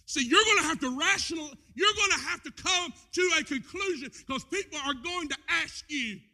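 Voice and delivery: loud, high preacher's cry; shouted sermon; preaching shout. Acted. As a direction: drive the point home to the church. Preaching to the congregation.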